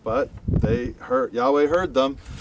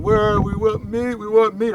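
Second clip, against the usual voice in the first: put-on high childish whine; mock the whining people; whining crowd; 8 semitones higher than usual. Moses imitating the people to God, whining.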